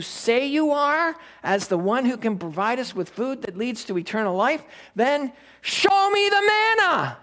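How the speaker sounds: high, pushy crowd demand; mock crowd demand. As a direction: ham up the crowd demanding a sign. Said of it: "they didn't quite say it that way".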